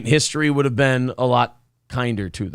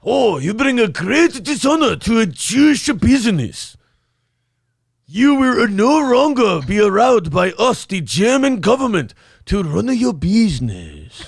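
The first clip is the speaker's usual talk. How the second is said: higher, stern mock German official; fake German accent; 5 semitones higher than usual. Shown as a demonstration. Host showing his own German accent attempt. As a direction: ham up a bad German accent. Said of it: "what I would have sounded like".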